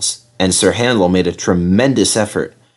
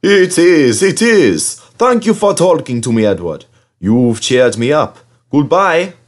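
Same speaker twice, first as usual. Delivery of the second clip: put-on old engine voice; 6 semitones higher, 3 dB louder than usual. A narrator's reading. Skarloey voiced talking to Edward.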